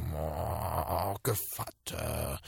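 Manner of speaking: low, slow wolf growl. Acted. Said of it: "so knurrte der Wolf".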